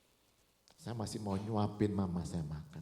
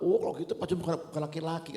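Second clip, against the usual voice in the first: acted taunt; 6 semitones higher, 6 dB louder, much faster than usual. Acted taunt in retold argument.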